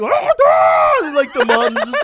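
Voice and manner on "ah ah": high, loud hammed-up scream; mock scream. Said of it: "And he's like".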